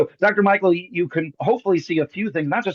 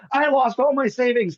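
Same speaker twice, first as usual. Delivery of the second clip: lightly voiced gleeful woman, higher pitched; gleeful audience member; 8 semitones higher than usual. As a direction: imitate someone cheerfully celebrating a loss. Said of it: "she's celebrating with fireworks".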